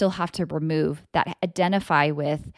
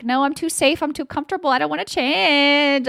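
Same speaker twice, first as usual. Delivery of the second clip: whiny; 9 semitones higher, 5 dB louder than usual. Pretend. Inner voice refusing change, whining high and childish. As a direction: whine like a child who won't budge. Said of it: "you're like that whiny thing".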